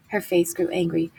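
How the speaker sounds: ordinary delivery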